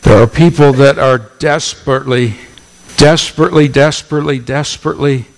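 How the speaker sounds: "desperately" repeated, loud then fading; building repetition. Acted. Preacher pleading about people trying to earn love, repeated emphasis. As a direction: hammer "desperately" and let it fall away.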